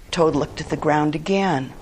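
ordinary delivery, nothing marked

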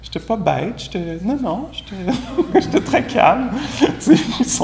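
high, laughing protest that breaks into giggles; mock your own denial while cracking up; laughing self-mockery. Teacher laughing at his own past claim, high and giggly. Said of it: "C'est ça que j'ai dit".

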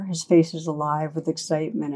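usual speaking voice

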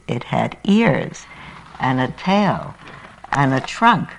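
animated rhyming storytelling; story reading. Acted. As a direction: read it like a children's book for the congregation. Preacher reads Seuss story aloud.

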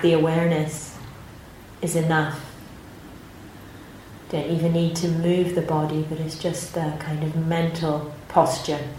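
calm, unhurried meditation guidance with long pauses; guided meditation. Acted. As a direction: gently guide the group into their posture and body.

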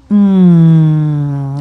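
long, loud "mm"; savouring hum. Shown as a demonstration. Teacher voicing a body that feels good, long pleased hum. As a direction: hum with pleasure, drawn right out. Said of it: "maybe there are places in the body that go".

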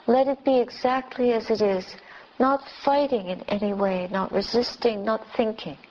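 normal talking voice

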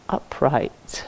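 ordinary talk

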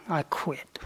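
soft, airless whisper of giving up; whispered "I quit", letting it all go; whispered aside. Pretend. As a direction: breathe it like a secret confession. Playful imagined quitting.

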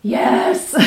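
yes flung out high and loud; bursting out. Retold or imitated. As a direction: let it erupt from the gut. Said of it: "this regurgitation of".

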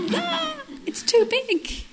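put-on high, loud, stretched-out little-boy cry; mock child wail. Boy outside facing how big it is, mock wail. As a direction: cry out high, dragging the words. Repeats the cartoon boy's cry.

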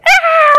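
loud, high exasperated shout; frustrated yell. Retold or imitated. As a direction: let out a burst of impatience. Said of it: "I was like".

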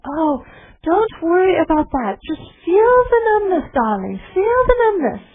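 put-on high, loud, sing-song German accent, a gentle old teacher; Ruth Denison German accent. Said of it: "I always hear the teaching in a German accent".

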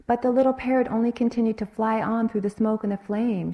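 plain reading voice

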